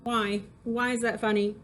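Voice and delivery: ordinary delivery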